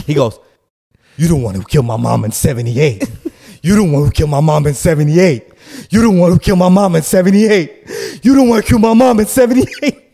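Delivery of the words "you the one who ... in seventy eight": building from firm to a high, loud accusing shout; accusing yell. Retold or imitated. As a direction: hammer the accusation, rising louder each time. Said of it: "I wanna stay in character".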